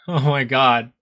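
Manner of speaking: ordinary talk, nothing marked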